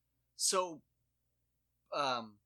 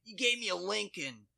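high, loud accusing voice; mock outraged customer; 8 semitones higher, 7 dB louder than usual. Cashier mimicking customer refusing a Lincoln bill. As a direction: spit out Lincoln in mock offence. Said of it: "He's like".

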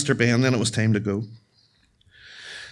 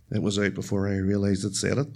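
usual speaking voice

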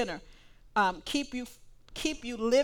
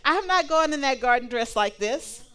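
a louder, offended no; indignant refusal; 3 semitones higher, 8 dB louder than usual. Acted. Woman in prom gown refuses garden work, indignant. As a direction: refuse like your good clothes are at stake.

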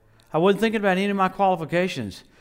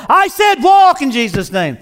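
loud, higher bellowed command; full shout; 7 semitones higher, 10 dB louder than usual. Retold or imitated. Wigglesworth's third command to the dead, full shout. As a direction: yell the order with everything.

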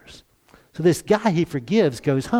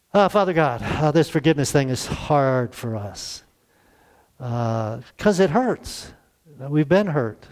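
prayer; 3 semitones lower than usual. Real, not acted. Closing prayer.

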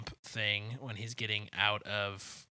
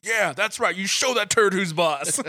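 much higher, loud laughing cheer; Tom Arnold cheering; 11 semitones higher, 15 dB louder than usual. Retold or imitated. Host imitating Tom Arnold cheering from a stall. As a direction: cheer him on from the next stall. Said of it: "Tom Arnold goes".

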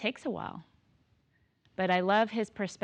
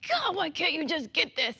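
higher-pitched exasperated outburst; exasperated mom; 10 semitones higher than usual. Retold or imitated. Mom loses patience with her kids' schoolwork, exasperated and high. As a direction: let the frustration spill over at the child. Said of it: "I am like".

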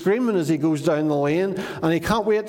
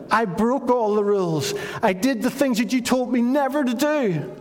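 desperate confession; 7 semitones higher than usual. Retold or imitated. Son's confession rises in pitch.

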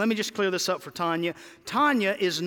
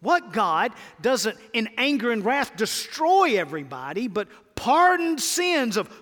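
rising wonder; 5 semitones higher, 5 dB louder than usual. Acted. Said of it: "Micah is asking".

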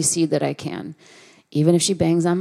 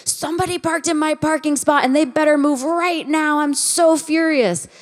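higher, hammed-up angry neighbor; imitating furious neighbor; 9 semitones higher than usual. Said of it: "banged on our door".

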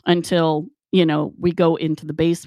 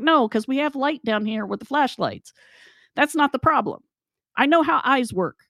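higher-pitched acted indignation; indignant protest; 7 semitones higher than usual. Rebutting Gary's rods and cones explanation, indignant. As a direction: insist to a sceptic, pitch riding high. Acted argument over seen lights.

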